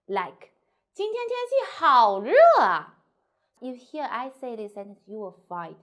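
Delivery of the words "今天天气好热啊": much higher and louder, 好热 punched; exclamation. Shown as a demonstration. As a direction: exclaim about the heat. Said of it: "The description word or the compliment will stress".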